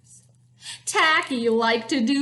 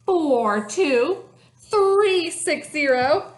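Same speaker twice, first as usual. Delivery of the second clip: loud count climbing higher, off-beat; marching count; 4 semitones higher, 4 dB louder than usual. A narrator's reading. Reader as Tacky marching for the hunters, marching count. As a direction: count out a silly march, building in gusto. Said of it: "And he marched".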